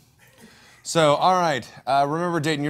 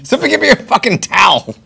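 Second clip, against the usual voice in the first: loud, high, exasperated yell; yelling for a towel; 10 semitones higher, 10 dB louder than usual. Real, not acted. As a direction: shout it half laughing, half fed up. Cake in eyes, demanding towel.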